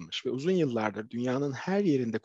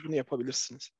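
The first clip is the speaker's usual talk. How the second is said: usual speaking voice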